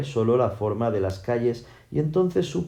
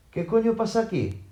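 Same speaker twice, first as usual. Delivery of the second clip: much higher-pitched, mildly indignant demand; indignant outburst; 9 semitones higher than usual. A narrator's reading. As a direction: demand an explanation, voice jumping up. Shears irrumpe ante el empujón. (indignado, con la voz aguda)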